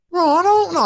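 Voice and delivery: hammed-up high-pitched squeak of nerves; panicked phone voice. Retelling his scared phone call to his brethren, high-pitched panic. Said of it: "I'm talking like this".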